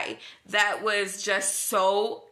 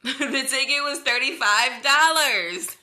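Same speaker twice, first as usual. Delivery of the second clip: higher, louder, through a laugh; laughing disbelief; 4 semitones higher, 5 dB louder than usual. Retold or imitated. Teller confirms the real price to cops, laughing disbelief. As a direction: crow the absurd price with a laugh.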